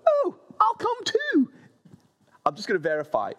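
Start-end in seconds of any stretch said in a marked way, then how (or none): 0.1-1.5 much higher, louder chirpy "I'll come too"; hammed-up keen friends joining in; eager ladies. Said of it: "three others go".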